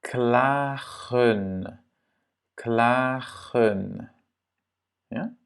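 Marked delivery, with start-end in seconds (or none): 0.0-4.1 klagen slowly, in two drawn-out syllables; long A. Shown as a demonstration. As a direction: stretch the A of klagen. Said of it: "le A est long". (« klagen » lentement, A bien long)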